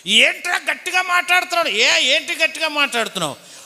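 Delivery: quarrel shout. Retold or imitated. Uncles shouting at each other.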